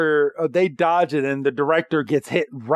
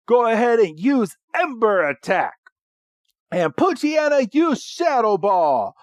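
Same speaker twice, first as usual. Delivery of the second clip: raised-pitch battle command, playfully acted; James; 7 semitones higher than usual. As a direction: call out attacks like a cartoon villain. Voices James ordering attacks.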